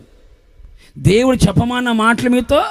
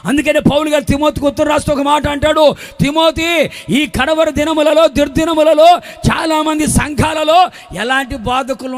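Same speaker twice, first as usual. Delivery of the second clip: high, driving sermon delivery; full-force preaching; 5 semitones higher, 4 dB louder than usual. Real, not acted. Preacher rousing the church about the foundation, full-force preaching.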